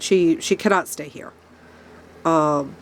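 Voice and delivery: ordinary talk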